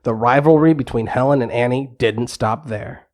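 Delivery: ordinary delivery, nothing marked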